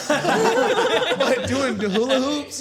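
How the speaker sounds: high giggling question; laughing. Real, not acted. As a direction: ask it through laughter. Laughing at girlfriend's hobby.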